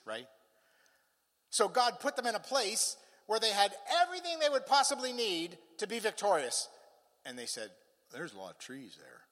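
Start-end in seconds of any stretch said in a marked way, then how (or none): 1.5-6.7 high, emphatic preaching. Real, not acted. Preacher marvelling at what God provided, high and emphatic. 8.1-9.2 low, quiet deadpan grumble; mock complaint. Tribe answering God's provision, low muttered mock complaint. Complaint repeated as comic punchline.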